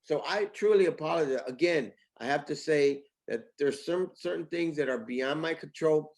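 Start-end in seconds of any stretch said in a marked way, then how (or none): none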